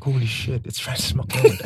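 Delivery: breathy whispered disbelief; stunned whisper. Retold or imitated. Recalling spotting the actress in a clip, whispered. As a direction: whisper it like you can't believe your eyes. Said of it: "I was like".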